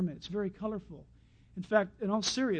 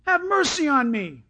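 crying out more; 5 semitones higher than usual. A narrator's reading. Blind beggar calling to Jesus over the crowd, crying out. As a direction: call out insistently, pitched up. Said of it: "he cried out all the more".

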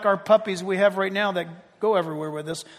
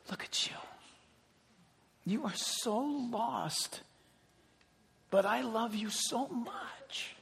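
slow, hushed voice of God; very soft, drawn-out whisper; 2 semitones higher, 12 dB quieter, slower than usual. Acted. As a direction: whisper it slowly, intimately, as God to one person. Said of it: "When he whispers to you".

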